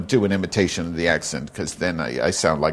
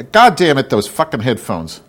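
sudden louder, higher curse; exasperated outburst; 5 semitones higher, 7 dB louder than usual. Real, not acted. Podcaster cursing unplugged headphones mid-rant, exasperated. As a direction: snap in frustration, loudly.

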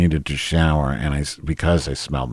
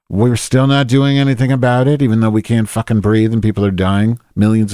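higher and louder, furious rant; angry outburst; 6 semitones higher, 5 dB louder than usual. Real, not acted. Ranting to listeners about climate inaction, angry outburst. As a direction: blow up at the inaction.

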